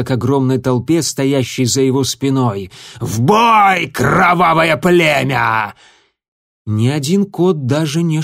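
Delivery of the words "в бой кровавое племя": high, loud commanding shout; battle cry. Acted. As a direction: yell the attack order. Leader commands army to attack.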